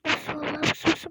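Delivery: loud insistent look; excited shout. Real, not acted. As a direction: call them to look, thrilled.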